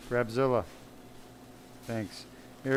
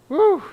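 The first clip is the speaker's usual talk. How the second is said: excited whoop; 11 dB louder than usual. Real, not acted. Celebrating the finished surgery.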